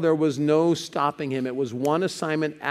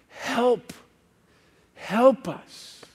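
higher-pitched, urgent cry for help; passionate, urgent plea; 8 semitones higher, much slower than usual. Acted. Macedonian man pleading with Paul in a dream, urgent and passionate. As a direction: plead urgently, each cry set apart. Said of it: "just passionately, urgently saying".

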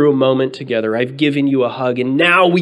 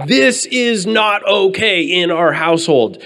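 put-on higher, slow stern correction of the kids; a firm dad laying down the rule; stern father; 7 semitones higher than usual. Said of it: "stern words".

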